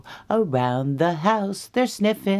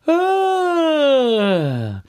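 long, high falling sigh; settling sigh; 13 semitones higher, 6 dB louder than usual. Acted. Bears climb into bed, long falling sigh. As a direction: sigh loudly, sliding down.

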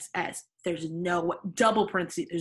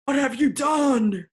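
loud, higher, drawn-out outrage; acted angry bellow; 6 semitones higher, 8 dB louder, slower than usual. A narrator's reading. Dumbledore walks in and confronts the students, angry bellow. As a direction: boom it out slowly in mock fury. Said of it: "Dumbledore walked in angrily".

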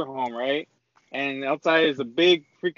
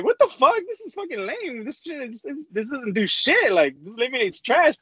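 scoffing disappointment; 8 semitones higher than usual. Retold or imitated. Stoned friends complaining the lemonade does nothing, scoffing. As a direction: scoff at the lemonade like it's a rip-off.

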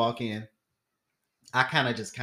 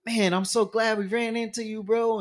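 higher, friendly bro voice; lightly voiced overfriendly rich guy; gushing stranger; 9 semitones higher than usual.

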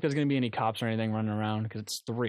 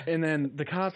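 normal talking voice

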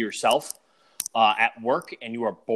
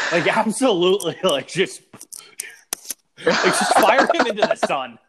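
exasperated shout; 8 semitones higher, 7 dB louder than usual. Real, not acted. Mock outrage at the coach.

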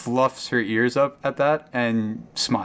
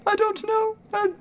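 much higher, anxious cow voice; frightened cow Claire. Acted. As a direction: squeak it out like a scared cow that saw nothing.

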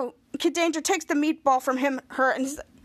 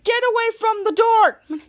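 slow, higher, louder yelled warning; hammed-up get-away yell; mock yell; 7 semitones higher, 5 dB louder than usual. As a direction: bellow it at the door. Disguised hero yells at door.

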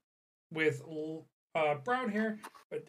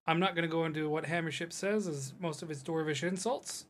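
ordinary delivery

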